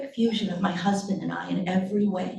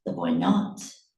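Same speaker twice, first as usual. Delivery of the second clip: usual speaking voice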